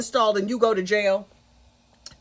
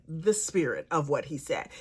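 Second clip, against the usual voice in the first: ordinary talk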